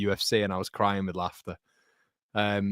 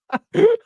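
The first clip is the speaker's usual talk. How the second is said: loud burst of laughter; 12 dB louder than usual. Real, not acted. Cracks up at own impression.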